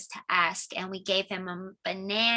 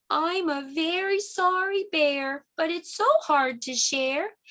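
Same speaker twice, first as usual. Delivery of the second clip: higher, slow sorry bear; 7 semitones higher, slower than usual. Acted. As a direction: sound ashamed as the bear.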